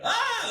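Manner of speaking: very high, soft mock shriek; surprised narrator's gasp. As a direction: imitate a startled little cry. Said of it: "clearly the narrator is surprised".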